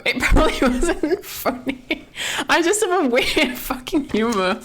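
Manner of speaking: high-pitched, talking through laughter; real giggling. Real, not acted. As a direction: admit it while still cracking up. Laughs at own odd humour.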